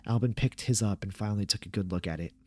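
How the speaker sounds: ordinary delivery, nothing marked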